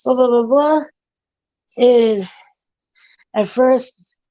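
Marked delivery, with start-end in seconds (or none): 0.0-0.8 raised, chatty skit voice; party mingler. Acted. Party guest mingling with a stranger, chatty small talk. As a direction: chatter on in a higher put-on voice. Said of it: "mingle and have a conversation".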